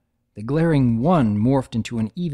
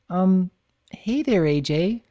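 shy pony voice; 6 semitones higher, slower than usual. Acted. Twilight greets Applejack.

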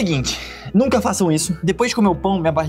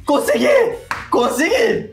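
triumphant shout; 10 semitones higher, slower than usual. Real, not acted. Swallowed the whole pepper.

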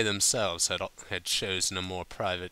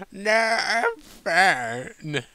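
high, loud, slurring with effort; tipsy Applejack; 13 semitones higher, 9 dB louder than usual. A narrator's reading. Drunk Applejack struggling to answer Rarity, high and loud. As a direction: force out each word, drunk. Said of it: "she finally responded with great effort".

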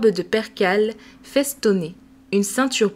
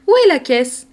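nurse asking; 6 semitones higher, 7 dB louder than usual. Acted. Nurse answers the child.

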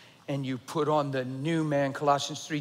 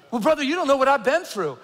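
higher, louder mock whine; self-pitying churchgoer; 8 semitones higher, 6 dB louder, faster than usual. Pastor mimicking a member who claims the most suffering, high and whiny. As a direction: play a member defending their hardship.

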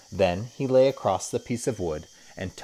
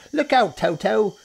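much higher, louder shouted warning; 8 dB louder than usual. Acted. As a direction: yell to save the children. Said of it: "shouted Humpy Rumpy".

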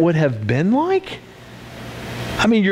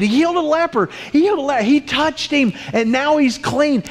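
excited gossip; 8 semitones higher than usual. Retold or imitated. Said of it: "word spreading through the crowd".

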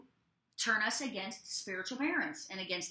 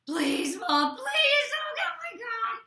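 "Please, Mom, please" shouted much higher and louder, desperate; frantic begging; 13 semitones higher, 7 dB louder, slower than usual. Retold or imitated. Child begging mom not to punish, shouted frantic plea. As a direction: wail it like a kid in a panic. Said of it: "there's times they're like".

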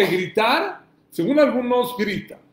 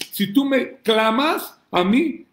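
normal talking voice

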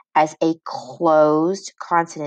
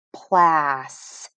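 usual speaking voice

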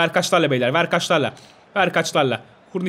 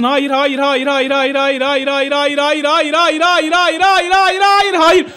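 repeated no, climbing higher and louder; rising dread to a shout; building panic; 6 semitones higher, 4 dB louder, much slower than usual. Real, not acted. Watching a disaster unfold.

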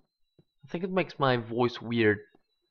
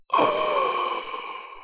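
sharp gasp. Real, not acted. Startled in dark cave.

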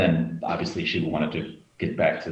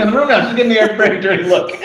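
high, loud laughing; 10 dB louder, faster than usual. Real, not acted. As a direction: laugh through the words. Wife walks in mid-secret.

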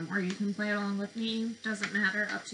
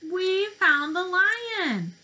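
higher, louder, slow and drawn out; excited discovery; 9 semitones higher, 8 dB louder, slower than usual. Acted. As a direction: celebrate the find with the kids. Kids' book discovery moment.

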